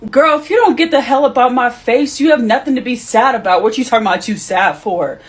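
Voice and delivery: loud, high scolding shout; angry mom yelling. Acted. Birth mom dismissing her daughter's sadness: loud angry yelling. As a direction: tear into her like a fed-up mother.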